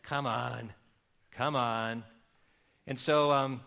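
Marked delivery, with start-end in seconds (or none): 0.0-2.0 low, soft, quick coaxing; God gently nudging him, much lower and softer; gentle voice of God. Retold or imitated. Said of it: "God starts to speak to me and says".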